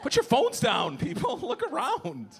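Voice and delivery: very high-pitched call to a crowd; exasperated appeal. Acted. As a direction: plead with onlookers, voice cracking upward high. Scolding bystanders who film.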